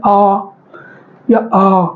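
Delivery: long, low-pitched "आ" shown as a vowel demo; drawn-out open "आ", tongue slightly back; long throat vowel. Shown as a demonstration. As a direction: hold each "आ" low, focused at the throat. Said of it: "गले पे focus करो और आ बोलेंगे".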